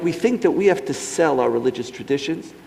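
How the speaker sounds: usual speaking voice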